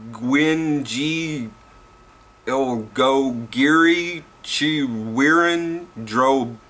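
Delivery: slow, strained try at a Welsh place name, sounded out as spelt; spelling out Llanfair as it looks, slowly and high; deliberate attempt. Shown as a demonstration. Vlogger tries the long Welsh town name for viewers, slow and effortful. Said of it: "so I'm gonna try to pronounce it for you-ins".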